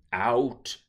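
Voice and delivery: loud single-word scream. Acted. Daughter ordering her mother out, loud scream. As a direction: shove the word out. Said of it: "she screamed".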